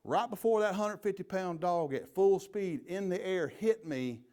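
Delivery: normal talking voice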